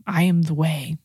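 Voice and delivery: hushed whisper of the words. Shown as a demonstration. Preacher whispers Jesus' words to the congregation in a hush. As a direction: breathe it as a secret to the listeners.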